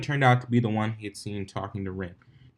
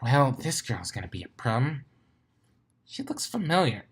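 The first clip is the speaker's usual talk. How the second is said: higher, rising voice; new character; 4 semitones higher, 4 dB quieter than usual. A narrator's reading. A character sizes up the familiar girl. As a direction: wonder aloud, puzzled.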